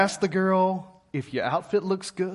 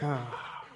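stunned ah; 6 semitones lower, 13 dB quieter than usual. Retold or imitated.

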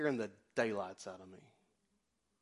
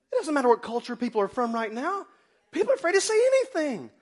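high, loud preaching cry; fiery preaching; 14 semitones higher, 11 dB louder than usual. Acted. Preacher crying out to the congregation. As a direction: preach it hard to the church.

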